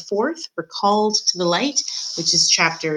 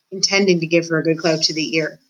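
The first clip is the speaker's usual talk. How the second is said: ordinary delivery, nothing marked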